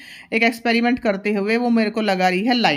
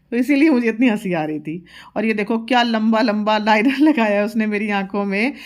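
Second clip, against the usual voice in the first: usual speaking voice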